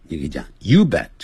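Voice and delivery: usual speaking voice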